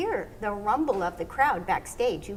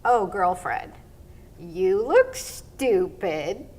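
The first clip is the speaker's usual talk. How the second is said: slow, drawled sassy tease; teasing partner; 2 semitones lower, 6 dB louder, much slower than usual. Retold or imitated. Partner teasing her after three falls, slow and sassy. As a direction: drag it out like a cheeky best friend. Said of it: "and he said".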